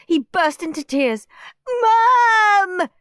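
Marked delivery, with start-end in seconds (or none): 1.8-2.9 high, loud tearful cry; a loud sobbing call; crying wail. A narrator's reading. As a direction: wail for Mum through tears. Peter crying calls Mum.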